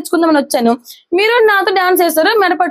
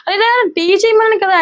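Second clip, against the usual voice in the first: plaintive plea; 5 semitones higher than usual. Retold or imitated. Husband pleads as wife leaves.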